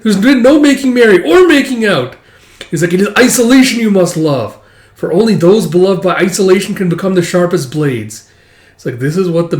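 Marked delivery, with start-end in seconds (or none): 0.0-2.2 high, loud, laughing mockery; a hammed-up taunt, laughing. Mocking a rival's lovesick life. 2.9-8.3 higher and louder, grandly declaiming; a hammed-up arrogant swordsman; lofty loner swordsman.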